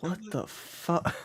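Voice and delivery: drawn-out quiet confusion; dumbfounded and slow; stunned slow whisper-like disbelief; slow baffled mutter. Retold or imitated. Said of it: "we're like".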